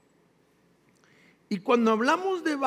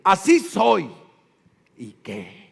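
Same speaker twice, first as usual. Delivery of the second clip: defiant; 6 dB louder, slower than usual. Retold or imitated. Stubborn excuse quoted.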